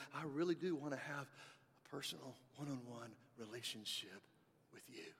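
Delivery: softening to a whisper; tender God. Retold or imitated. God speaking personally to each listener, fading to a whisper. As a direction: let God's invitation fade into a whisper. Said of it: "God's saying".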